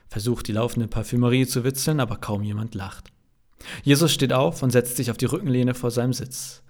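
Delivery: plain reading voice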